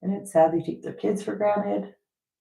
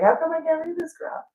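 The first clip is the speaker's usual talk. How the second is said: frustrated; 10 semitones higher than usual. Retold or imitated. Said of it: "I would be, like, mad".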